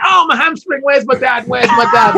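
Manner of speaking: high, loud, whining shout breaking into laughter; mock wail of the injured runner. Friend teasing Derek on the team bus with a high laughing wail. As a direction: ham up a crying sprinter calling for his dad. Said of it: "Like that".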